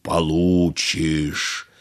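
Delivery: low, very slow thunderous genie; booming thunder and wind. A narrator's reading. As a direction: let each word roll like thunder. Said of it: "голосом, подобным грому".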